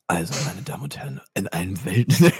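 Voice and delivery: grand announcer voice, half joking; mock announcer voice. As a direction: announce it like a gala host. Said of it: "mit deiner hervorragenden Stimme".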